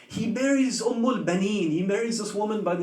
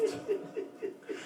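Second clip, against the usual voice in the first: voiceless sob; 10 semitones higher, 13 dB quieter than usual. Real, not acted. Weeping preacher breaks mid-story.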